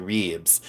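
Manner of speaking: RIBS stretched as "reebs"; mock Southern "reeebs"; imitated Southern drawl. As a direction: mimic the Southern server's long "ee" in RIBS. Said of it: "it had three E's".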